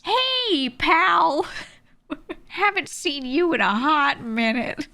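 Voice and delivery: butter her up like a fake old pal; fake friendly DM. Old acquaintance sliding into her DMs, high mock-friendly. DMs after next tease.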